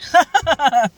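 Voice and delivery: sudden high cackling laugh; loud high laugh breaking out; burst of laughter. Real, not acted. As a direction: laugh out loud at your own story. Laughing at own pissing story.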